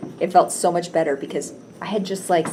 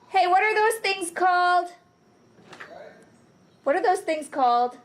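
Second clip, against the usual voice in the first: repeating to voice assistant; 3 dB louder than usual. Real, not acted. Speaking to voice assistant.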